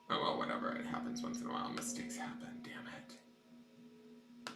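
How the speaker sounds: whispered to herself; hushed grumbling under breath; whispering. Real, not acted. Muttering under breath after mistake.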